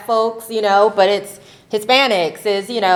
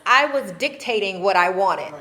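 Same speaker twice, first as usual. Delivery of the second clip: ordinary delivery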